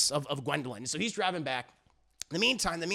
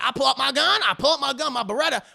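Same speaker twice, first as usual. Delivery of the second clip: excited bragging; 5 semitones higher, 6 dB louder, faster than usual. Retold or imitated. Character excitedly tells of drawing gun.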